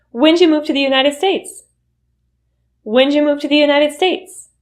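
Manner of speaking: When'd you said as whenja, pitch lifted; reduced question. Shown as a demonstration. Question a newcomer may be asked, reduced. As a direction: ask When'd you move to the United States with whenja. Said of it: "The U turns into J".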